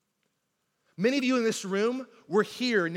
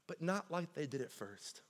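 much softer, lower ending; quiet contrast; 4 semitones lower, 13 dB quieter than usual. Acted. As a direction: drop the voice on the contrast. Repeated sermon line.

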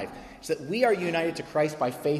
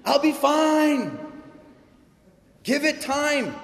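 much higher and louder, slow, airy brush-off voice; mock breezy dismissal; 12 semitones higher, 5 dB louder, slower than usual. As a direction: ham up a carefree don't-worry voice. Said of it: "She didn't say".

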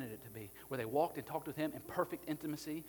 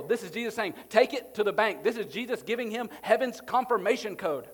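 loud, driving sermon delivery; preaching at full force; 8 semitones higher, 12 dB louder than usual. Acted. Pastor to the church about the cross, loud fervent preaching. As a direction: hammer it home to the congregation.

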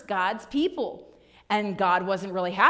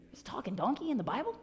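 surprised adults; 8 dB quieter, faster than usual. Retold or imitated. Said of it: "and like".

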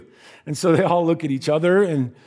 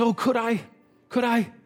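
hopeful plea; 7 semitones higher, 4 dB quieter than usual. Acted. Agnes is offered the cake to take home, hopeful. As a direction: ask it high and wondering, twice over.

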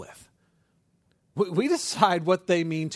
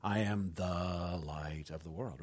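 low, soft, staring intense voice; drawn-out unblinking delivery, low and slow; intense stranger; 10 semitones lower, 9 dB quieter, much slower than usual. Retold or imitated. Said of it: "They will not break eye contact".

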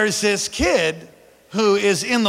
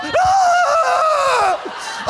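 high, loud mock scream; kid's scream; 5 dB louder than usual. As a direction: scream like the frightened boy. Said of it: "He's like".

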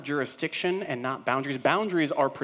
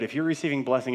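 ordinary talk, nothing marked

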